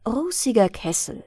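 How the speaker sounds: ordinary delivery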